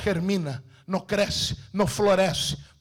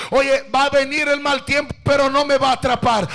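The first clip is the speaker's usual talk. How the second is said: ordinary talk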